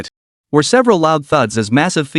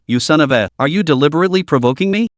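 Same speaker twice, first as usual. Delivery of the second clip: usual speaking voice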